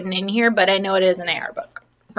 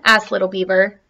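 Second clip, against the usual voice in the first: ordinary talk, nothing marked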